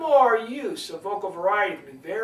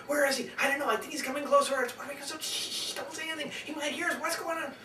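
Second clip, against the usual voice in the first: hushed, higher, urgent back-and-forth; nervous whispering; 4 semitones higher, 3 dB quieter, faster than usual. Acted. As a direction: whisper nervously as if someone might hear. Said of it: "very nervous about".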